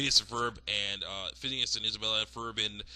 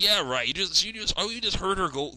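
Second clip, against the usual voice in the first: loud, higher incredulous retort; mock scoffing outburst; 7 semitones higher, 6 dB louder than usual. Captor dismisses mom's threat, loud scoff. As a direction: scoff loudly as the unimpressed captor.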